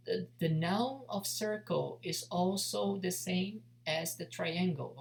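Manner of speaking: ordinary talk, nothing marked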